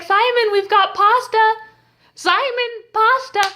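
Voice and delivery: high singsong call through a door; drawn-out, high-pitched summons. Retold or imitated. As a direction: imitate a mom calling her son to eat. Family calling through the door.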